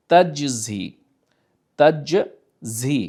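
তাজজি with jim then za, drawn out slowly; tajweed pronunciation. Shown as a demonstration. Teacher showing students how to pronounce তাজজি. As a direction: say তাজজি slowly, stressing the doubled consonant. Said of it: "জিম আসছে, ঝা আসছে".

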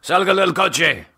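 sharp, high barked order in Spanish; barked command. A narrator's reading. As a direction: bark the order at him. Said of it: "barked a command in Spanish".

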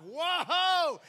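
loud, high burst of wonder; overwhelmed shout of joy; excited whoa. Retold or imitated. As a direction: blurt it out in amazement. Remembering being saved, exclaiming.

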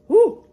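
high loud whoop at the heat; hot food whoop. Real, not acted. Burned by hot chitlins, high loud whoop. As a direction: yelp out at scalding chitlins.

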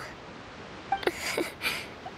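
light, high breathy giggle; a gentle grateful chuckle; soft happy laugh. Acted. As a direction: laugh softly with relief. Grateful for their help.

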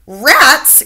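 high, loud shocked yelp; shocked cry. Acted. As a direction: recoil at the word. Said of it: "cried Mister Stringer".

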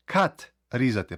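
ordinary talk, nothing marked

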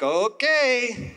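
loud, high, drawn-out okay; reluctant agreement. Retold or imitated. Preacher giving in to God's request, exaggerated reluctance. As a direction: give in loudly, stretching the word.

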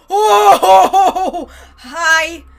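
loud startled laugh; nervous scared laugh. Real, not acted. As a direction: laugh off the fright loudly.

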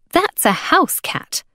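put-on high, slow, scornful girl; open scorn. A narrator's reading. Girl exposing the hawkers' fake wildcat, scornful. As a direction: debunk it with disdain. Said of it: "unable to disguise her scorn".

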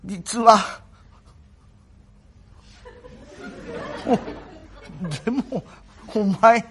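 very slow, halting, bashful sick voice; hesitate, too shy to say it; shy invalid. Acted. The sick young master, too shy to confess to Kuma, slow and halting.

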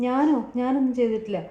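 high, loud startled denial; shocked denial. Retold or imitated. Accused girl denies pouring the hot water, shocked and loud. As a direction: protest your innocence in disbelief.

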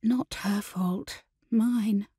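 soft, slow, near-whispered admission; hushed self-blame; murmured. Acted. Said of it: "Sarah murmured".

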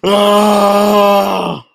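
sharp high gasp then cry. Real, not acted. Big moment in the race.

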